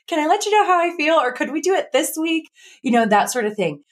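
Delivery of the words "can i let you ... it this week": quick, much higher polite request; lightly asking a friend to push plans back; tentative polite asking. Retold or imitated. Retold polite way to postpone plans.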